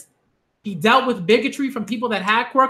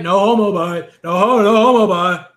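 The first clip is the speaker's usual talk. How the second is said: mocking catchphrase; 3 semitones lower, 4 dB louder than usual. Host parodies men scared to admit crying, mocking. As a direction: mimic the defensive catchphrase with exaggerated force.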